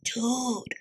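hushed warning to a friend; whispered. A narrator's reading. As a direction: say it under your breath. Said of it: "Don Diego whispered".

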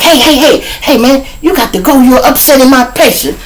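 doctor telling the cop to leave now; stern doctor. Retold or imitated.

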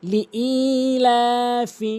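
LI-ILAFI with a long held "ee" after li; slow Quranic recitation of লিইলাফি; correct recitation. Shown as a demonstration. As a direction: draw out the ee and laa in লিইলাফি. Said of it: "সঠিক উচ্চারণ হচ্ছে".

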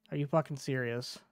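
ordinary delivery

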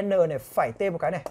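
ordinary talk, nothing marked

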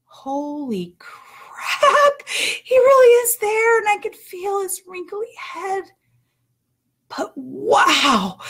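amazed. Retold or imitated. Mother reliving feeling her baby's head, astonished and tearful. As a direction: let the awe break your voice.

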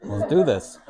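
laughing through an eager yes; retold reply bubbling with laughter; laughing quote. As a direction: giggle while agreeing. Retelling her excited reply to dad.

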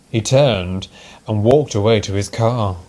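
plain reading voice

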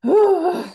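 big loud sigh letting it out; a forceful, high releasing sigh. Shown as a demonstration. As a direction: heave the breath out loud. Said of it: "I might make a sound".

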